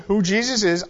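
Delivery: normal talking voice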